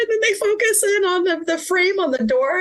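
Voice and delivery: very high, squeaky tearful voice; laugh-crying as you get the words out. Real, not acted. Podcast guest recalling a beloved finale scene, crying. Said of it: "I'm gonna start crying now".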